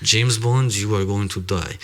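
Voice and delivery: hammed-up Russian movie-spy accent; deliberate, exaggerated Russian accent. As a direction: play a Russian film villain, heavy accent. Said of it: "I might be better at the Russian English accent".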